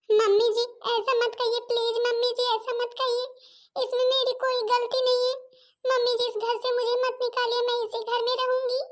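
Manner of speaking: daughter-in-law begging not to be thrown out, tearful; tearful pleading. Acted. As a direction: plead to stay, near tears. Bride begs not to be expelled.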